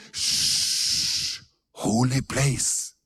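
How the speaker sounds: mocking hushed whisper; whispered pious shush. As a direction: hush them like in a church. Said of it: "og da sa folk".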